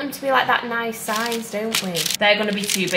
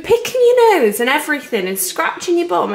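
raised, louder nagging; scolding rant; 6 semitones higher, 5 dB louder than usual. Real, not acted. Baker tells off partner for dirty hands, loud nagging. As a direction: tell someone off in a rising tirade.

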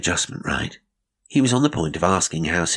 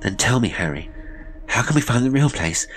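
high, loud, building to a cry; pleading ever harder for help; desperate urging; 5 semitones higher, 4 dB louder than usual. A narrator's reading. Sister begging her brother to send help, rising to a cry.

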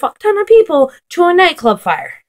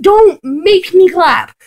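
ordinary delivery

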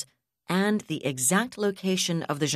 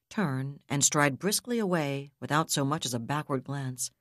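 plain reading voice

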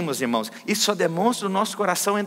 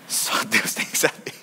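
hushed aside; 12 semitones lower, 4 dB quieter than usual. Real, not acted. Keeps his prayer private.

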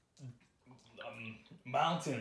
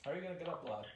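normal talking voice

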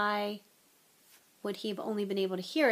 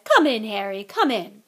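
loud, hearty welcome; beaming; 11 dB louder than usual. A narrator's reading. Lockhart welcoming Harry into his office, beaming. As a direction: usher him in heartily, much louder. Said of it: "Lockhart beamed down at him".

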